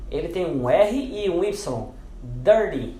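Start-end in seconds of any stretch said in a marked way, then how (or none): 2.2-3.0 "dirty" with a D sound after the R. Shown as a demonstration. Teacher gives the example word, "dirty" with T said as D. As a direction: say "dirty" with its T softened to D. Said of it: "vai também ter o som de D".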